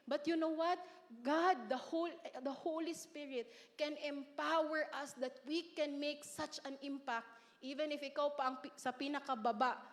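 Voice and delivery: normal talking voice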